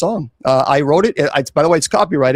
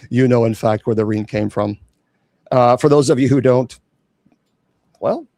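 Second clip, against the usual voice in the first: ordinary talk, nothing marked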